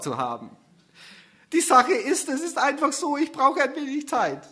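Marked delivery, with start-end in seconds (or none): 1.5-4.4 much higher, louder teary voice; tearful confession. Acted. Susanne asking Gerhard for more time, high and teary. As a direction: admit it through tears. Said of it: "sagt Susanne".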